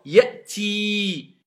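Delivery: Ya'ti with the final ee held long; long vowel. Shown as a demonstration. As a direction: stretch the end of Ya'ti. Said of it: "C'est une voyelle longue ou pas?".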